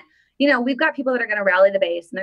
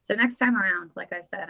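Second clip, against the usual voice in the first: usual speaking voice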